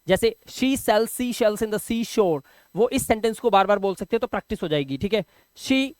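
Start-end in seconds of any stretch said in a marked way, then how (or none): 0.5-2.4 very slow, careful tongue twister drill. Shown as a demonstration. Teacher models the sa-sha practice line very slowly. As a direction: spell out every sh and s slowly. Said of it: "वो बोल सकते हैं".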